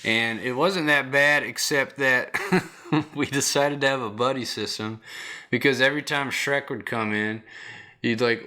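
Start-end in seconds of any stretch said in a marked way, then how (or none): 2.4-3.1 short laugh. Real, not acted. Laughing at the story.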